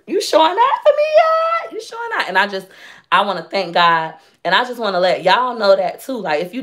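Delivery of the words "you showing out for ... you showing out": very high, squealed disbelief; amazed exclamation. Retold or imitated. Grateful woman re-enacts asking God about blessings, amazed squeal.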